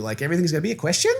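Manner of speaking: "everything's gonna be a question" rising like a question; quick phrase, lifting upward on "question"; upward inflection. Shown as a demonstration. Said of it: "upward inflection too".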